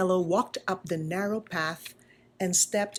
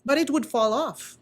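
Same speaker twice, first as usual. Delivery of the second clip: ordinary delivery, nothing marked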